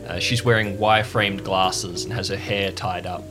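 usual speaking voice

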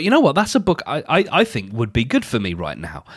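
usual speaking voice

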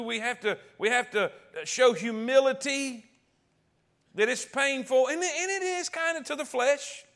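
high, loud preacher's climb; full-force preaching. Acted. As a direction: preach it hard and rising.

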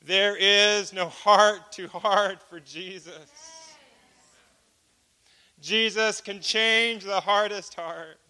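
slow, loud preacher's proclamation; preaching. Acted. Preacher tells the congregation Jesus changes hearts, slow and loud. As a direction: lean into each word like a pulpit declaration. Preacher drives home the point.

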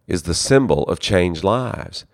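ordinary talk, nothing marked

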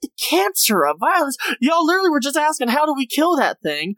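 high-pitched exasperated disbelief; incredulous echo. Retold or imitated. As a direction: throw the phrase back in scornful disbelief. Host's incredulous echo of the phrase.

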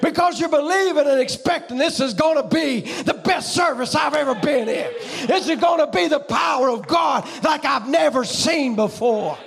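a pastor firing up the church at full voice; fiery preaching. Acted. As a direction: preach it at full force to the congregation.

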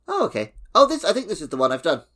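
ordinary talk

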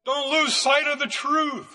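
ordinary delivery

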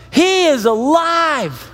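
slow, high, emphatic declaration; triumphant proclamation. Acted. Preacher announcing the dead man lives, triumphant. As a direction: draw out each word in wonder.

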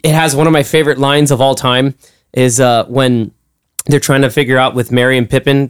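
ordinary talk

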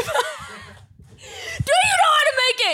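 high, laughing squeal of a quote; laughing mock quote. Retelling a bar story, laughing high through the quote. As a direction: laugh through the bartender's line. Retelling bartender mocking a guy.

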